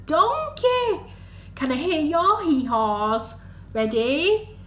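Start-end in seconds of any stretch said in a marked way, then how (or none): none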